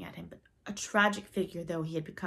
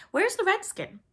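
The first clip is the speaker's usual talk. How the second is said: high, loud, sudden demand; abrupt demand; 14 semitones higher, 9 dB louder than usual. A narrator's reading. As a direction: demand it abruptly of your crew. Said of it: "he demanded abruptly".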